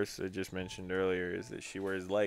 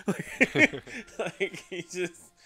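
laughing; 9 semitones higher, 4 dB louder than usual. Real, not acted. Laughing about the impression.

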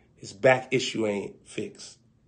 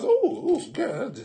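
startled cover-up; 12 semitones higher, slower than usual. Acted. Man caught, covering up.

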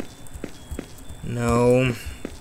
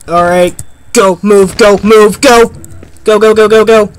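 high, loud panicked shouting; being chased; 7 semitones higher, 7 dB louder than usual. Real, not acted. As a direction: urge yourself on while fleeing. Fleeing statue in game.

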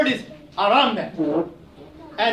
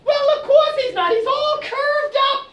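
squeaky hedgehog. Acted. Hedgehog argues with Aso, a high indignant squeak. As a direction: protest in a tiny outraged voice.

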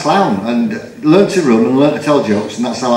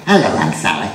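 Wurzel Gummidge; 7 semitones lower than usual. Retold or imitated. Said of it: "I did Wurzel Gummidge".

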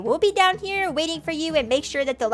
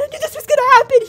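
higher, louder, fast panic; panicked; 10 semitones higher, 7 dB louder, faster than usual. Real, not acted. Trapped in the attic with friends, higher, louder, fast panic. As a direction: blurt it out in a burst of fear.

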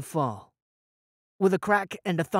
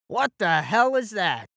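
startled stranger; 4 semitones higher, 3 dB louder than usual. Acted. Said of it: "A voice spoke".

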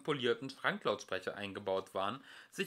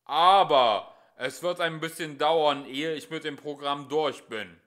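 calm booming; 4 semitones higher, 11 dB louder than usual. A narrator's reading. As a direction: say it calmly but huge. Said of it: "ruhig fortfuhr".

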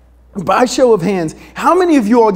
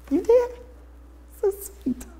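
squeaky, much higher mock swoon; swooning wife; 13 semitones higher, 8 dB quieter than usual. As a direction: gush like a touched wife, cooing.

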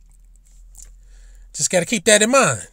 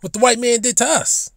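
ordinary talk, nothing marked

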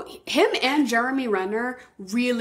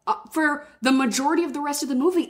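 ordinary talk, nothing marked

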